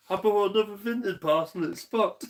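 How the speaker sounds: shrill-free high mock voice; mocking own voice. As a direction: parody his own chatter in a high voice. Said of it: "I'm just in the background going".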